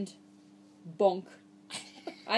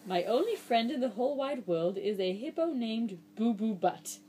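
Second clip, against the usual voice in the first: usual speaking voice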